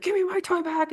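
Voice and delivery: put-on high-pitched childish grab for the toy; snatch it back like an indignant kid; mock demand. We could resort to violence.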